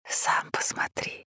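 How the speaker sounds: slow ear whisper. A narrator's reading. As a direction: breathe it into his ear. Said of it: "едва слышно прошептал ему на ухо".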